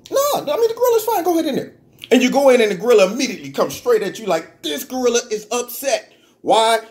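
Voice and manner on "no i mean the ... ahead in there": much higher, innocent-sounding liar; high mock voice. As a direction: play it high and falsely innocent. Handler lying about the gorilla.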